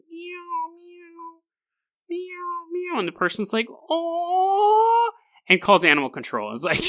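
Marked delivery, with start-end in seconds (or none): 0.1-3.0 tiny, very high, soft mewing; faint high-pitched kitten cries; baby kitten meows. Retold or imitated. Imitates five baby kittens mewing. 3.7-5.1 high, long-held cooing "aw"; mock-gushing high squeal of aw; melting aw. Retold onlooker melting at kittens.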